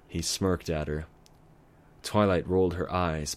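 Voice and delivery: plain reading voice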